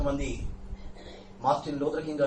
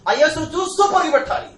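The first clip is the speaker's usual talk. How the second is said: higher and louder, hitting పరుగు hard; loud preaching repeat; 11 semitones higher, 8 dB louder than usual. Acted. Preacher urging the church to run, loud call. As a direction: burst out after the hushed line.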